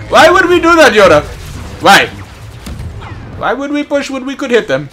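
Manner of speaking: loud, high mock protest; indignant yell at the game; exasperated outcry. Real, not acted. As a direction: throw the question back loudly at the teammate. Mocking teammate in game.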